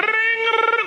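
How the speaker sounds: high shouted ring; bell ringing. Retold or imitated. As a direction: yell it like an alarm bell. Imitates alarm bell.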